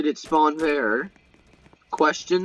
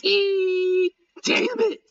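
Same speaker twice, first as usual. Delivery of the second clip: high-pitched frustrated squeal; much slower than usual. Real, not acted.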